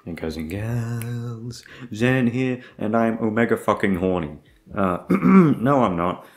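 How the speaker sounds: ordinary talk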